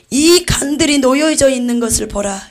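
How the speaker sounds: loud solemn command; solemn divine command; God commanding. Acted. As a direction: point and command the servant to look. Voicing God's command.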